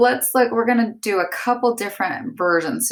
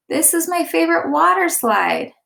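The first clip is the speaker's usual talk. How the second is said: much higher, loud thrilled cry; exclaimed; 10 semitones higher, 4 dB louder than usual. Shown as a demonstration. Teacher acting out an excited kid, thrilled cry. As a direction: shout it out with delight. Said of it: "I exclaimed".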